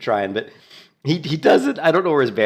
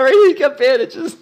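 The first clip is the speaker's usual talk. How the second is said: laughing; 9 dB louder than usual. Real, not acted. Laughs finding bandages.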